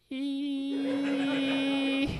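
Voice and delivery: high flat electronic beep; one long machine tone; monitor beep. Retold or imitated. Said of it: "his heart rate monitor goes".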